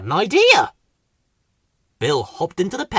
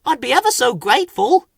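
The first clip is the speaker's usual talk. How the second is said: high, quick, grateful voice; raised eager creature voice; Whoops; 10 semitones higher, 5 dB louder, faster than usual. A narrator's reading. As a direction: gush thanks quickly. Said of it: "said Whoops".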